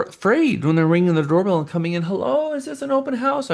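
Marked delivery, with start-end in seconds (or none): 2.0-3.5 high, hesitant hello; timid visitor. Retold or imitated. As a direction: peek in nervously, much higher. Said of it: "people are afraid".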